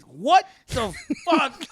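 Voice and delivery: high, loud, laughing fake outrage at Frank; mock exasperated yell. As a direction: bellow at your friend in playful annoyance.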